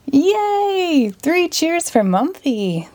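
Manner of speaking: high, excited cheer; cheering crowd. A narrator's reading. Audience cheering the magician, high and excited. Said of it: "the audience yelled".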